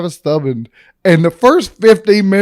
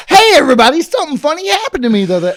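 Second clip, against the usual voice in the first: TikTok intro voice; 9 semitones higher, faster than usual. Retold or imitated. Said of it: "I'm gonna do another one".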